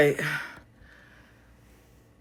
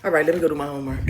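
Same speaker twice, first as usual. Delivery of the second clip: ordinary delivery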